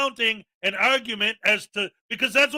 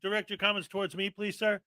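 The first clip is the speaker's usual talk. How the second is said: much softer courteous plea; polite request; 3 semitones lower, 11 dB quieter than usual. Retold or imitated. Chair asking the speaker to face her, softly. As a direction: ask gently, almost under the breath. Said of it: "she's like".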